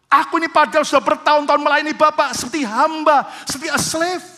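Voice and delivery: loud, high, indignant complaint to his father; angry son. Acted. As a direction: hurl the accusation at the father, offended. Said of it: "Dia marah".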